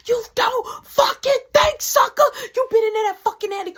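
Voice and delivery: very high, loud furious screech; a mother losing it at her son; screaming mama. Retold or imitated.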